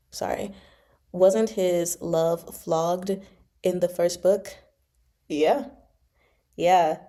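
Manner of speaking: ordinary delivery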